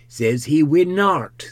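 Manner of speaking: lightly voiced countryman passing on news; rustic relaying. Hall relays what he overheard.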